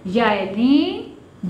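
याए लीन drawn out slowly; slow teacher's heading; slow announced term. Shown as a demonstration. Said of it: "जब ये से पहले हर्फ पर ज़बर".

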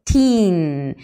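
put-on "Teen" with a long drawn-out ee; teacher stretching "Teen" for the class. Shown as a demonstration.

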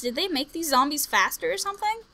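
ordinary talk

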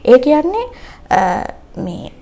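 normal talking voice

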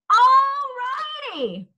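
huge high whoop then drop; boy bellowing at full voice; bellowed. A narrator's reading. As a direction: bellow it to shake the land. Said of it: "he bellowed so loud".

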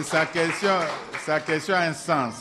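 forceful loud affirmation; emphatic insistence. Real, not acted. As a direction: insist loudly that it matters. Insists the question makes sense.

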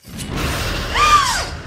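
sharp loud gasp of shock. Real, not acted. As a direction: gasp out loud, caught off guard. Shocked by plot twist.

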